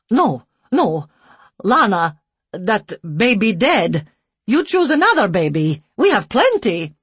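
loud, blunt broken English. A narrator's reading. Care worker brushing off the couple, loud broken English. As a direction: shrug off a death in broken English. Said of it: "in broken English".

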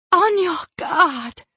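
high, drawn-out cry of alarm; shouted warning. Acted. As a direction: call it out high to warn your companions. Warning cried out in a fight.

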